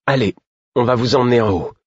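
lifted, coaxing encouragement; encouraging. A narrator's reading. Servant helping an unsteady man upstairs, encouraging. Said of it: "me dit-elle d'un ton encourageant".

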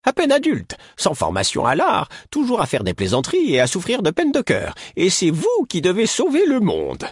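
put-on a high old healer lecturing two youths; old woman Caudron scolding. A narrator's reading. Caudron voiced in story.

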